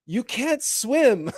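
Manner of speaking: drawn-out protest, voice lifted high; high, slow mock Frodo pleading; worried Frodo. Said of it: "and Frodo says".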